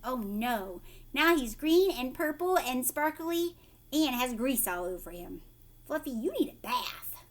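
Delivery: plain reading voice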